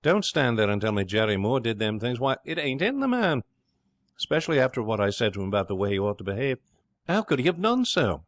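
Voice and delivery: agitated disbelief, leaping up high; rattled. Acted. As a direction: protest in rising, flustered incredulity. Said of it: "Gentleman's rattled".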